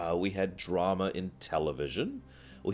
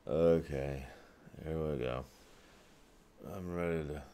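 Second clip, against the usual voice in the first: sleepy in bed; 4 semitones lower, 8 dB quieter than usual. Retold or imitated. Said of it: "I'm just in bed like".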